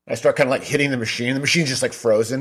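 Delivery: ordinary talk, nothing marked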